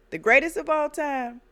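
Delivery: high, loud mocking praise; mock him with fake admiration; sneering fake praise; sarcastic. Sarcastic jab at Trump.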